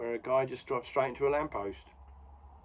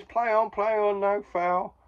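high, loud referee call; shouted ref call over the crowd; ref calling play on; 10 semitones higher, 7 dB louder, slower than usual. Retold or imitated. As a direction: bark it out like a ref waving play on.

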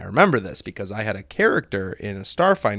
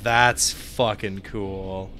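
ordinary talk, nothing marked

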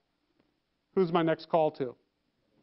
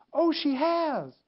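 high, drawn-out mock surprise; feigned surprise; 8 semitones higher, much slower than usual. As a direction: act as if this is news to you. Feigns surprise at vacation news.

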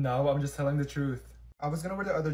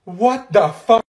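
shocked outburst; 5 semitones higher, 13 dB louder, much slower than usual. Acted.

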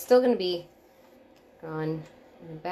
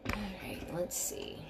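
whispered; 9 dB quieter than usual. Real, not acted. Working quietly on hair.

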